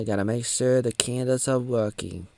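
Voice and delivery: ordinary talk, nothing marked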